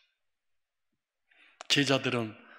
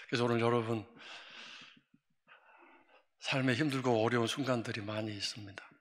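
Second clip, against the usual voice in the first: ordinary delivery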